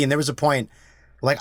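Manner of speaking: ordinary talk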